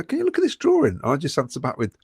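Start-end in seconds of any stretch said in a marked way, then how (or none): 0.0-1.0 squeaky high mock of the Birmingham fan; mock whiny fan. Host mimicking a Birmingham fan pestering him at work, high squeaky mock. Mocking a Birmingham fan's request.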